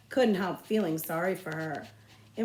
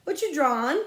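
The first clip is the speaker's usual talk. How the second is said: put-on higher, louder pushy kid; nosy boy; 8 semitones higher, 7 dB louder than usual. Bully leaning over Jess's desk, higher and louder. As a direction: poke at a classmate's business.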